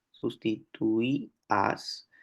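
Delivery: sustituías drawn out slowly with the stressed í split from the a; teacher modelling sustituías slowly for the class; slow demo word. Shown as a demonstration. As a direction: stretch sustituías to show the hiato. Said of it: "podríamos decir que hay un hiato".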